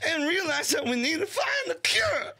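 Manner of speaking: very high, strained, crying shout; stammering, shrieky comedian voice working itself up; Bobcat Goldthwait. Acted. Doing a comedian on covid, frantic high impression. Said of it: "I could do it as Bobcat Goldthwait".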